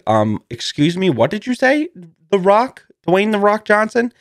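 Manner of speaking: rising, high disbelieving questions; incredulous. Retold or imitated. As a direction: act stunned at the name. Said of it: "and I said".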